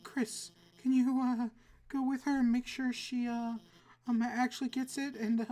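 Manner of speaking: flustered teacher losing control; nervous teacher. Acted. Alphys voice again.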